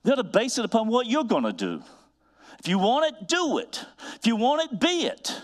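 high, fervent fatherly exhortation; soaring, insistent preacher's push; daddy moment. Real, not acted. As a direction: preach it like a dad laying down the law. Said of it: "That's my daddy moment".

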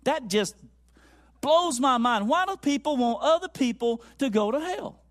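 loud, high, incredulous pulpit delivery; animated full-voice sermon; impassioned preaching. Acted. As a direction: preach it hard, driving each point home. Preaches to congregation.

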